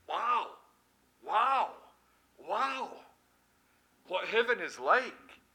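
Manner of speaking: amazed, rising wow of wonder; awed wow. Acted. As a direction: gasp out wow in awe. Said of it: "I'm going".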